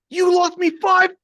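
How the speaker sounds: high, loud cursing yell; retold furious yell. Trader yelling about the five thousand lost, furious yell. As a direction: spit it in his face over lost money. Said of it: "like this in my face".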